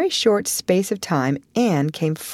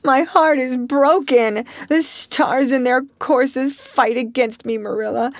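dramatic grief; 7 semitones higher than usual. Acted. Anne laments her fate to Marilla, tragic. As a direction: play the tragedy to the hilt. Anne's voice.

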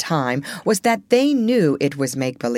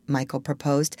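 ordinary delivery, nothing marked

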